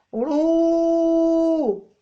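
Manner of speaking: long sustained رو with a held vowel; drawn-out syllable ru. Shown as a demonstration. Teacher modelling the syllable, drawn out. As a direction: hold the رو sound steady. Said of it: "الراء رو".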